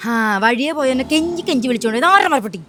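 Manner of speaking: angry retort. Acted. Woman arguing back at the man who blamed her, indignant. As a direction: throw the blame back, heated.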